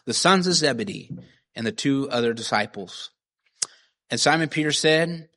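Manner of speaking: ordinary delivery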